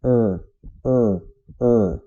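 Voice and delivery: "er" as a curled R, loud and separate; English R sound. Shown as a demonstration. Teacher drilling the letter R, loud curled "er". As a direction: say "er" three times with clear gaps. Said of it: "toda vez que pensar no R".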